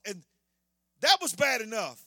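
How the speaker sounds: ordinary talk, nothing marked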